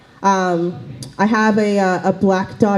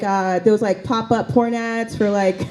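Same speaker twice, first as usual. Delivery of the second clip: usual speaking voice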